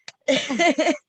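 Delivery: high, loud embarrassed laugh; short high laugh through emotion; emotional laugh. Real, not acted.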